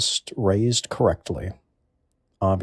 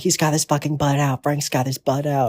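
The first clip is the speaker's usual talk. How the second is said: higher, strained imitation of alarmed kids; mock kids' screaming; 5 semitones higher than usual. Kids warning each other on the playground, mock screaming. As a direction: imitate a playground alarm cry without real volume. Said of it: "start screaming and be like".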